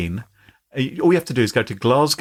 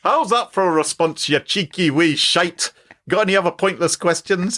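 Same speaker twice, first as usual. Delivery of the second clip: higher, cheeky mock-Scottish retort; put-on Glaswegian accent; 5 semitones higher than usual. Chatbot sassing the person asking, in cheeky Glaswegian accent. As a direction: taunt them in a hammed-up Glasgow accent. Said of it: "Could you freelance a Glaswegian accent".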